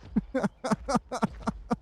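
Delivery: short high-pitched giggle. Real, not acted. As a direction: giggle at yourself. Laughs at own mistake.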